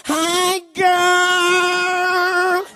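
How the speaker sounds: put-on high, sing-song hi girl; mock girly greeting. Preacher imitating how girls greet each other, sing-song and high. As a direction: sing it out like a gushing girlfriend.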